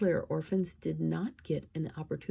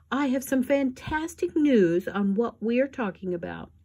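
high, loud gushing announcement; thrilled society lady; gushing excitement; 7 semitones higher, 4 dB louder than usual. Acted. Esme announces news.

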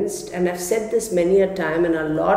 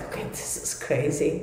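breathy whisper to herself; hushed, disbelieving whisper; 4 semitones lower, 9 dB quieter than usual. Retold or imitated. Retold freak-out over rash.

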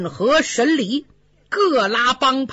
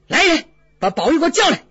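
angry summons; 6 semitones higher, much faster than usual. Acted. 贾政怒召宝玉，急促高声下令. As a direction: bark the order to fetch him. Father summons son angrily.

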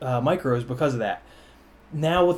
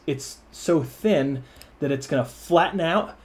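normal talking voice

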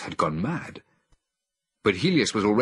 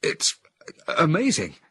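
stammering in amazement; 10 semitones higher, 5 dB louder than usual. A narrator's reading. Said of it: "I stammered".

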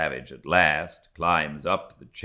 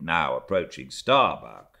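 plain reading voice

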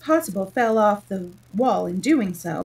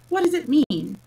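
impatient interruption, pitched high and louder; 7 semitones higher, 5 dB louder than usual. A narrator's reading. Humpty Dumpty interrupting Alice about her name, impatient. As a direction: cut in with a raised, impatient question. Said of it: "Humpty Dumpty interrupted impatiently".

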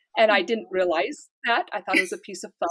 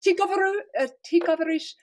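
Russian question; 7 semitones higher than usual. Retold or imitated. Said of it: "someone said".

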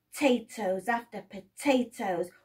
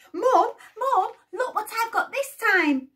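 excited calling; 10 semitones higher, 6 dB louder than usual. Acted. Jack calling to mother.

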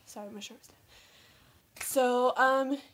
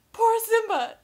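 mock pity wail; 9 semitones higher, 8 dB louder than usual.

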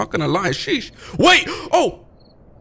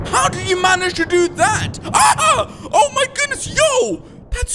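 horrified shout; 13 semitones higher than usual. Real, not acted. Gamer finding a mangled villager, horrified shouting. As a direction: shriek at the grim sight.

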